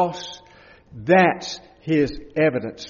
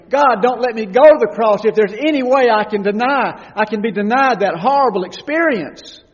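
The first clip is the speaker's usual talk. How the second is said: high, loud begging cry; loud desperate plea; 7 semitones higher, 9 dB louder than usual. Acted. Preacher voicing the Son begging God, high loud plea. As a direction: plead as if facing the cross.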